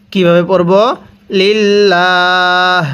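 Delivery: usual speaking voice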